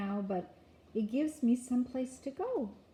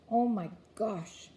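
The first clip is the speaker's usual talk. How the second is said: plain reading voice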